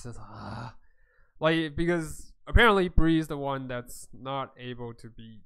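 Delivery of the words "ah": low, soft sighed "ah". Acted. Vor reacts to Bree's apology, low soft sigh. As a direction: let out a low, quiet sigh. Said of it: "you see Vor just sighs".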